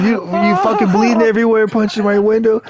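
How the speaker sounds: friend's girlfriend scolding, mock angry; mock angry rant. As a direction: play her going off, half-hearted. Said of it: "fucking mad and going off on me".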